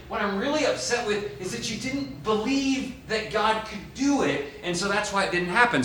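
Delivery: a hint of a disappointed teacher; voicing Jesus. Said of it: "He's saying".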